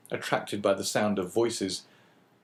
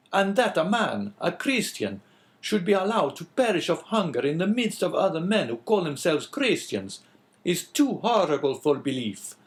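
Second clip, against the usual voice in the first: higher, louder, indignant outburst; outraged protest; 8 semitones higher, 4 dB louder than usual. A narrator's reading. Priest horrified at the old man's death, indignant. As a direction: rail against the cruelty of it. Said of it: "springing from his seat".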